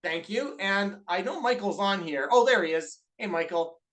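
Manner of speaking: normal talking voice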